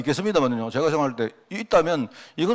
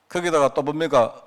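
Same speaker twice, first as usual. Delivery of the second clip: ordinary talk, nothing marked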